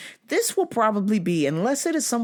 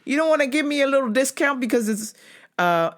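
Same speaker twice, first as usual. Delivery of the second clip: wheedling plea; 8 semitones higher than usual. Retold or imitated. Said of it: "I was like".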